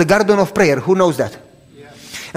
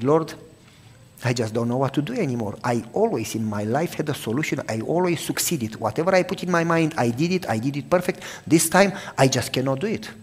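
tearful prayer; 3 semitones lower, 8 dB quieter than usual. Retold or imitated. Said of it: "I cried the whole night and prayed".

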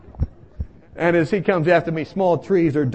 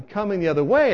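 usual speaking voice

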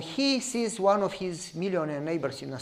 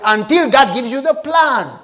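higher and much louder, losing patience; final exasperated insistence; insistent loud repeat; 6 semitones higher, 12 dB louder than usual. Retold or imitated. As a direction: hammer the answer home. Third repetition, now insistent.

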